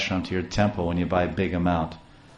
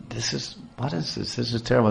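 dismayed wife; 2 semitones higher, 5 dB quieter than usual. A narrator's reading. Wife tends the boy's scratched shoulder, soft dismay. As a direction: murmur over the wound in distress.

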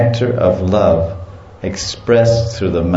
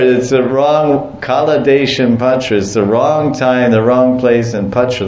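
normal talking voice

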